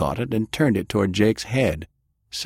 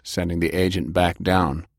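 plain reading voice

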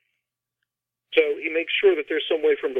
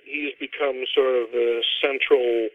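ordinary talk, nothing marked

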